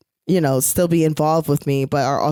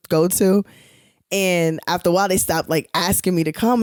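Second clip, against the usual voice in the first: usual speaking voice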